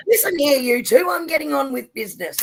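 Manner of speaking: playfully stern, high and loud; hammed-up order to behave; mock stern.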